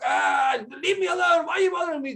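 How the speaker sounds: hammed-up irritated brush-off; a stranger snapping at a nosy question, in a mock yell; annoyed outburst. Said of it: "if the person's like".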